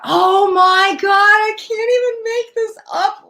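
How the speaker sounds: high, slow and wondering, can't believe the card; thrilled disbelief. Real, not acted. Reader amazed at the card she drew, thrilled. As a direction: gasp it out, amazed it fits. Tarot card confirms her reading.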